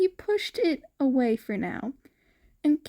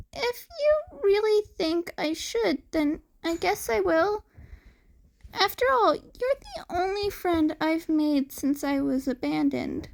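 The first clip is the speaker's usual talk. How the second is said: put-on high boy voice, sincere and open; earnest cat boy; 6 semitones higher than usual. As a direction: give in gratefully.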